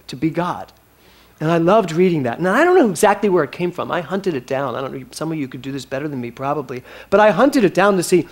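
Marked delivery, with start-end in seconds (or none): none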